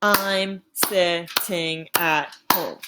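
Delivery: slow, syllable by syllable; counting syllables slowly. Shown as a demonstration. Teacher counts the syllables in "I'm sitting at home", slowly. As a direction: tap out each syllable slowly. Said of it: "That's five syllables".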